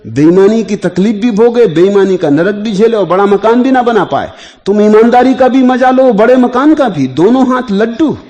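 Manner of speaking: high, loud oratorical delivery; impassioned preaching. Real, not acted. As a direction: drive each phrase home loudly to the crowd. Discourse rising to full force.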